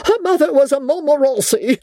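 put-on high-pitched, outraged old lady; acted outrage in a high voice; indignant cry. A narrator's reading. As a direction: protest it high, in affronted tones. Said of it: "cried the old lady".

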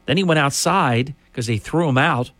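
ordinary talk

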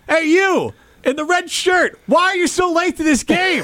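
high, slow public shaming call. Pretend. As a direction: call him out over the PA. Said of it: "or shame 'em".

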